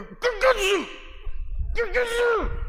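very high, loud mock yell; mock grumbling shout. Preacher acting himself heckling the coach, high mock yelling. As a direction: holler it like a frustrated fan.

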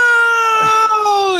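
very long, high wailed "no"; anguished sobbing cry. Acted. Player as Jack hearing her confession, anguished sobbing cry. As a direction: howl it out loud in despair. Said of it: "he's sobbing".

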